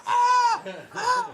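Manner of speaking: high, loud imitated scream; short high-pitched mock screams. Imitating his friend screaming after the handrail hit, mock screaming. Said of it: "And he's screaming".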